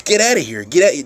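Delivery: loud snapping get out; sudden loud get-out yell; mock yell. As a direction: bark it like shooing someone off. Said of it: "That's what you wanna do".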